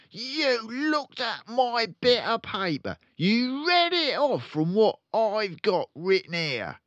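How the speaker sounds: loud, high accusing father; furious dad accusing; angry father. Acted. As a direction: jab every word at her.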